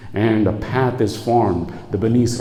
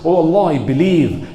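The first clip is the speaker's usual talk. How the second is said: raised, louder cry of faith; higher, forceful mock shout; retold shout; 6 semitones higher, 5 dB louder than usual. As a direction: call out like a drowning man, imitated. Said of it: "He shouts".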